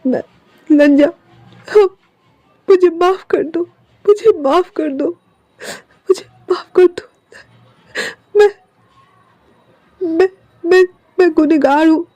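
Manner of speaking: choked-up guilty apology; tearful confession. Acted. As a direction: beg forgiveness through tears.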